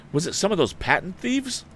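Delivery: high, urgent question; eager question. A narrator's reading. Tom urging the wounded man, high and eager. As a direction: press him urgently. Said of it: "cried Tom".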